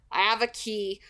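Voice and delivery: ordinary delivery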